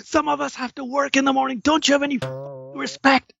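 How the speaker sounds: high-pitched mock scolding; an imitated outraged mother lecturing; retold scolding. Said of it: "like, so pissed".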